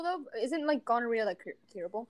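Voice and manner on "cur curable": ordinary delivery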